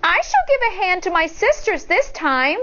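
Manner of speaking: high, lightly voiced little sister; eager to help; youngest child's voice. A narrator's reading. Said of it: "Poits, the youngest, said".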